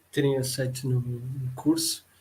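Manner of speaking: ordinary delivery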